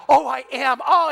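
light imitation of a happy mum-to-be; beaming, lightly acted; thrilled reply. Pregnant woman answers thrilled.

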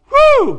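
a high, loud exultant "whew"; joyful whoop. Real, not acted. Preacher rejoicing over being spiritually filled, loud whoop. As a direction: let out a whoop of delight.

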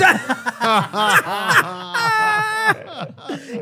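long high-pitched cackle; helpless laughter. Real, not acted. Friend reacting to the bartender's punchline, high helpless laughing. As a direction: crack up in a drawn-out squeal.

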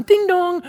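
high, sing-song doorbell chime; ding-dong sung out like a bell; doorbell imitation. Imitating a doorbell in a dinner story.

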